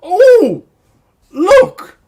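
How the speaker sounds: high, loud and delighted; excited gasp. Acted. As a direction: burst out with delight at a discovery. Improv scene, discovering vegetables.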